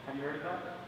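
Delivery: ordinary talk, nothing marked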